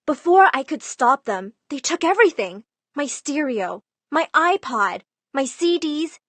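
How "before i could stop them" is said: ordinary delivery, nothing marked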